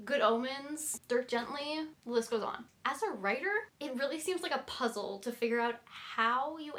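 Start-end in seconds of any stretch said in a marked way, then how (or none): none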